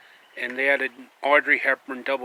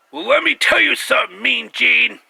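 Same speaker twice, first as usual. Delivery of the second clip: much higher, much louder wrestling promo roar; Hulk Hogan impression; 10 semitones higher, 9 dB louder than usual. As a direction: bellow it like a wrestler cutting a promo. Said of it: "like Hulk Hogan".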